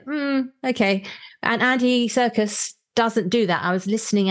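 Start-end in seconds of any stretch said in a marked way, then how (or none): 0.1-1.0 sceptical hmm. Retold or imitated. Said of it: "I got a little bit".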